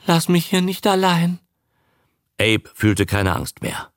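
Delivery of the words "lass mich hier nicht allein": high, pleading little voice; frightened stammer. A narrator's reading. Frightened woman begging her man not to leave, stammering plea. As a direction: plead in a higher, anxious voice. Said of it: "stammelte Lee".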